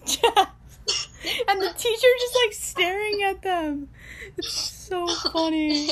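real giggles breaking through, high-pitched; laughing while talking. Real, not acted. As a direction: tell it while cracking up. Cracking up telling a funny story.

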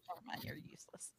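hushed whispered aside. Real, not acted. [name] dismissing Steiner, whispered aside. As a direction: mutter it under your breath. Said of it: "whispered aside".

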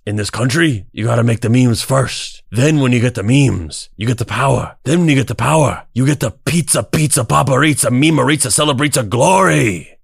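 loud, high Tony Montana voice; hammed-up Cuban gangster; Scarface impression. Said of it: "And it says".

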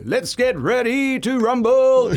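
drawn-out high "rumble" call; boxing ring announcer. Retold or imitated. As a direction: belt it like a fight-night MC. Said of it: "kinda riffing on that whole".

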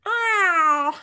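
very high, loud squeal; cat person voice. Retold or imitated. Cat-person voice joke continues.

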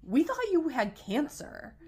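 high, loud, stretched retold outburst; hammed-up exasperated cry; exclaimed retort. Friends explaining their fear in retold scene, exclaiming.

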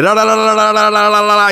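rapid la-la-la laugh on a steady pitch; mock la-la laugh; imitated odd laugh. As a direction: rattle off a la-la-la giggle. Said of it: "って笑って".

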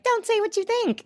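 tiny high-pitched squeak of a rule; mock repressed generation. Host mocking what their generation was told, high squeaky mimicry. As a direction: pipe it up high as a prim mock admonition.

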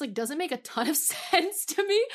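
ordinary talk

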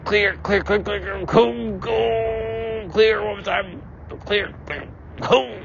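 high, rapid clear calls; mock medic. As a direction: bark clear like a paramedic. Mock defibrillator scene.

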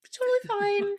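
high, light, mock-carefree; mock breezy. Cohost mocking a victim's politeness, high breezy fine. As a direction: brush off a carjacking like nothing. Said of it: "I'm like".

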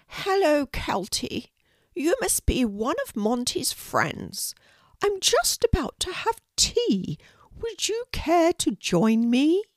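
a kindly Queen welcoming a guest, pitched well up. Acted. The Queen inviting a shy girl to tea, high regal voice.

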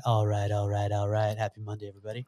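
low, soft celebrity impression; Matthew McConaughey. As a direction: redo the celebrity impression, low-key and hushed by the end. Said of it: "Let me hear it again".

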